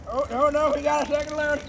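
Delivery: normal talking voice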